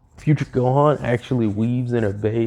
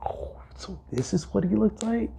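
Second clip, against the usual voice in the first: hushed, higher awed whisper; 8 semitones higher, 7 dB quieter than usual. Retold or imitated. The kids whisper about young Gohan, hushed and awed. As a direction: breathe it out in wonder. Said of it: "They were just like".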